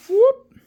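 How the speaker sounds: a squeaky high whoop; high whoop sound. Retold or imitated. Narrator voicing Kel passing through the wall, high whoop. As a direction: pop the sound out like a magic trick.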